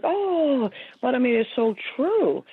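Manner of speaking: high, drawn-out oh of recognition; moved realization. Retold or imitated. Readers responding to a signed book message, moved realization. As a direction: imitate readers struck by how true it is.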